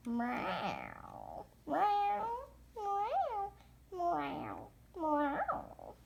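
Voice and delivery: drawn-out pretend meows; imitated kitty mewing; cat performance. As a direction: meow in varied tunes like a cat on stage.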